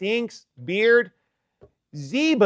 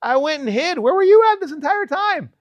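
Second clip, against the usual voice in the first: much higher, mock-angry king demanding answers; a hammed-up high demand, getting louder by the end; 10 semitones higher, 3 dB louder than usual. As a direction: play the offended king, pitched way up. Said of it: "and the king is mad".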